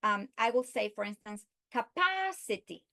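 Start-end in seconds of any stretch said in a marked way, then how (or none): 1.7-2.8 PA in "capacity" pitched high; stress on antepenultimate syllable. Shown as a demonstration. Teacher illustrating antepenultimate stress, PA stressed in "capacity". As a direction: jump up on PA in "capacity". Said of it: "third syllable of a word counting from the end".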